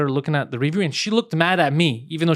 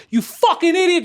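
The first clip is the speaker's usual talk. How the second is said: a much higher yelled insult at a driver; retold furious yell; 14 semitones higher, 4 dB louder than usual. Retelling road rage, yelling at another driver, high furious yell. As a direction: scream it like you've had enough. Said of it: "yelling at someone like".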